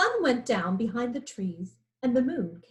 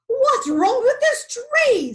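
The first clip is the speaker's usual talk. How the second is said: slow, much higher, louder lion voice; dizzy lion; 13 semitones higher, 10 dB louder than usual. A narrator's reading. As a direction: wail it as the tree shakes. Lion dizzy in shaking tree.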